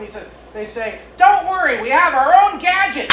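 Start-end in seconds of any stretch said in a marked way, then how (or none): 1.2-3.1 high, loud inspectors' reply; raised-voice group declaration; proud punchline. Acted. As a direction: belt out the punchline, pitched well up.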